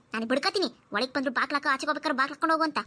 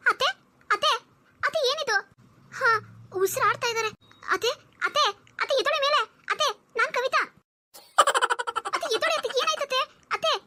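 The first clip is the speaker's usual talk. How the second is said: alarmed calling; 9 semitones higher than usual. Acted. Wife trying to wake mother-in-law.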